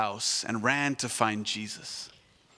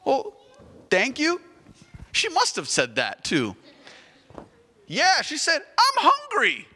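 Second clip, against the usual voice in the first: put-on very high, loud little girl; 13 semitones higher, 5 dB louder than usual. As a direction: play a bright child just woken.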